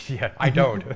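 real laugh while saying I don't; amused protest through laughter. Real, not acted. Laughing at fog delay joke.

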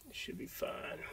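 under-the-breath whisper to himself; a quiet whispered mutter; whispered aside. Real, not acted. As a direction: whisper it while tending the fire. Muttering to himself over fire.

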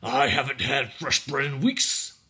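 slow, drawn-out complaint; complaining. A narrator's reading. Dow tugging at Ellen's arm, slow complaint. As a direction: complain with effort while tugging. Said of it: "Dow complained".

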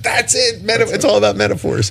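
high, soft exclaim of sudden insight; excited realization. Retold or imitated. Recalling his own eureka moment, excited high voice. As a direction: burst out with the discovery, voice jumping up. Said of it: "I went".

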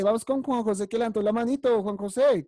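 usual speaking voice

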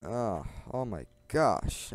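usual speaking voice

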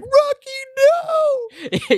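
very slow, very high, loud wail; anguished shout. Retold or imitated. Host voicing Jerry as Rocky gets sucked in, hammed-up anguished wail. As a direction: stretch the cry of despair out. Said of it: "And Jerry's like".

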